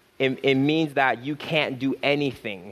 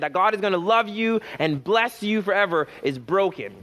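preaching at full force; 5 semitones higher than usual. Acted. Pastor urging the congregation, preaching at full force. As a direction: hammer the point home to the church.

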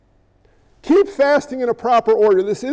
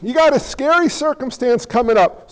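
ordinary delivery

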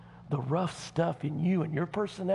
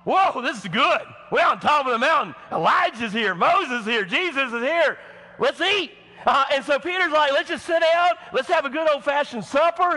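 much higher, loud, eager Southern dude; excited Peter; 9 semitones higher, 7 dB louder than usual. Pretend. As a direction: blurt it out all fired up. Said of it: "he just blurts out and he goes".

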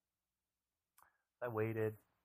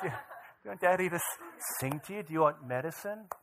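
questions bubbling with laughter, higher; can't keep a straight face; father retelling his offer to his son, laughing through it; 8 semitones higher, 11 dB louder than usual. Retold or imitated.

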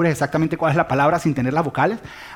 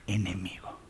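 dramatic whisper; 9 semitones lower, 13 dB quieter, slower than usual. Acted. Preacher to the congregation about the worst enemy, whispered. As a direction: whisper enemigo, unvoiced and soft. Sermon climax on loving enemies.

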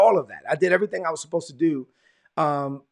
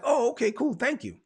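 high, lightly acted polite thanks; retold awkward, higher-pitched reply; polite acceptance; 9 semitones higher than usual.

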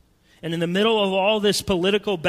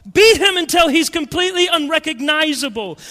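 loud, higher mob demand; mob shout; 9 semitones higher, 7 dB louder than usual. Retold or imitated. Preacher voicing the mob's demand, shouting. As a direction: hurl it like the crowd.

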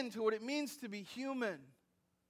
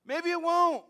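emphatic; 7 semitones higher, 7 dB louder than usual. Real, not acted. Preacher pushes back.

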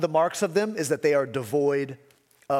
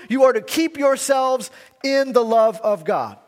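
higher and louder, hammering the contrast; emphatic preaching; 7 semitones higher, 5 dB louder than usual. Acted. Preacher stressing the apostle's contrast to scoffers, emphatic. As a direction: drive home the "but you".

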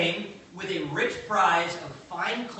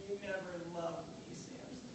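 much softer, breathy put-on sulk, last word unvoiced; a pouting wife trailing off; mock sulky hush; 13 dB quieter than usual. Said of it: "She pleaded, she begged".

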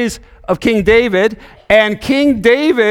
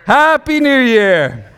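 laughing greeting; 6 semitones higher, slower than usual. Real, not acted.

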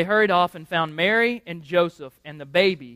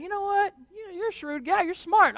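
much higher-pitched acted voice; impressed ruler; 12 semitones higher, faster than usual. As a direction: play a ruler conceding admiration, pitched up high. Acts out Octavian's reply to Herod.

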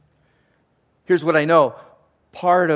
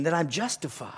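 whispered; 6 dB quieter than usual. Real, not acted. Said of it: "unvoiced whisper".

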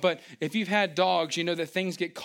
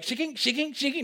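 shear noise; 9 semitones higher than usual. Retold or imitated. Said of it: "they were like".

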